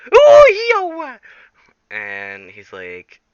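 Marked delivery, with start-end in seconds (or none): 0.1-1.2 very high, loud startled squeak; squeaky minion. Acted. Minions squeak in surprise at Jack. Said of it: "the minions are like".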